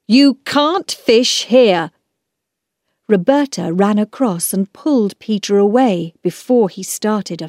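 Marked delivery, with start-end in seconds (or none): 0.1-1.9 slow, raised, emphatic scolding; angry storybook man; cross man. Acted. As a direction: forbid it firmly, spacing out the words. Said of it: "shouted at them".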